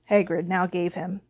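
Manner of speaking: plain reading voice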